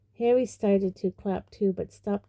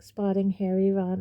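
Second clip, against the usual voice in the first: plain reading voice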